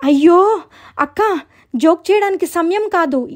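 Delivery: high, pleading little sister; acted flustered insistence. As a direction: plead to be believed. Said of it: "ఎందుకంత కంగారు పడుతున్నావ్".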